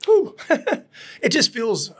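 choked-up, laughing through tears; real emotion catching in the voice; getting teary talking about feeling better. Real, not acted. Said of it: "I get all teary".

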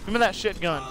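ordinary talk

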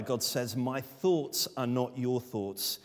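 ordinary delivery

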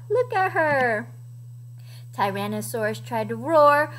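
usual speaking voice

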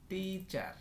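Teacher split as tee, then char; syllable by syllable. Shown as a demonstration. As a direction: sound out teacher in two parts. Word broken, then said whole.

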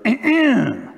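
a high, hammed-up little throat clear; exaggerated ahem, pitched high; dramatic throat clear. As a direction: clear your throat to get noticed. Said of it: "she dramatically cleared her throat".

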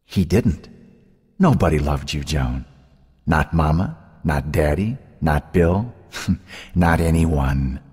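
cold, measured spite, drawing out the end; venomous. A narrator's reading. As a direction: needle her with understated venom. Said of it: "Her sister's voice turned venomous".

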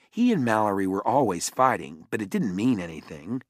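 usual speaking voice

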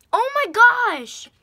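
very high, loud, drawn-out squeal; ecstatic shriek. Real, not acted. Showing viewers the lipsticks, a real high loud excited shriek. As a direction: shriek with delight.

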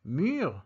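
"mûr" with a rounded French u and uvular r. Shown as a demonstration. Teacher giving example words, careful French "mûr". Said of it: "Another example will be mûr".

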